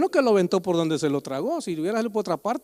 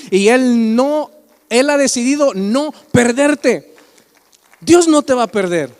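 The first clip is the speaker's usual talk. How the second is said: Dios no te va a perder, louder and strong; emphatic proclamation; 3 semitones higher, 9 dB louder than usual. Real, not acted. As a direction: declare it firmly to the congregation. Preacher proclaims God's promise. (proclamación enfática)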